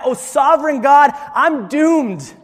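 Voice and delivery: loud, high-pitched cry of alarm; acted awestruck outcry. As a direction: cry it out in preacher's dramatized dread. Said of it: "he cried out".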